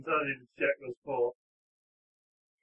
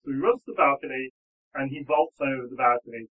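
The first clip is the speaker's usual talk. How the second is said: normal talking voice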